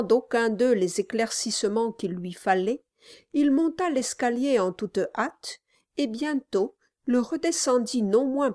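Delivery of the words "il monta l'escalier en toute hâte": plain reading voice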